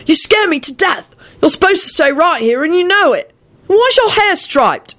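high-pitched, worried telling-off; scolding. A narrator's reading. Scolding a child who wandered off.

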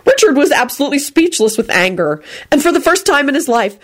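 plain reading voice

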